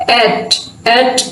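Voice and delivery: "एट" drawn out slowly, vowel like a in cat; short a demo. Shown as a demonstration. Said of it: "इसको आप बोलें तो एट की तरह की साउंड".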